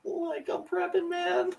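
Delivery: high, far stoner voice; lazy, high-pitched stoner dude drawl; Shaggy impression. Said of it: "I have a really good Shaggy impression".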